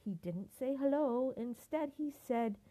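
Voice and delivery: ordinary delivery, nothing marked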